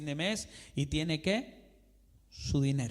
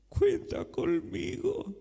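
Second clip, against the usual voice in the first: "conmigo" drawn out slowly and pitched much higher, a strained plea; desperate; 13 semitones higher, slower than usual. Retold or imitated. Preacher voicing a believer answering God out of desperation, high and drawn out. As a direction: stretch "cuenta conmigo" high and slow like a cornered plea. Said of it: "de desesperación".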